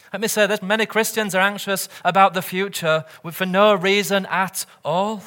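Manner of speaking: ordinary talk, nothing marked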